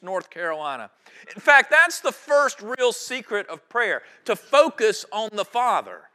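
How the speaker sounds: rising pulpit preaching; full-force preaching. Real, not acted. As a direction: preach it out to the congregation.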